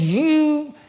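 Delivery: a sharp, high, furious "You"; angry outburst. Retold or imitated. Angry father cutting off the preacher, furious. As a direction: spit it out at the preacher in rage. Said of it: "He got mad".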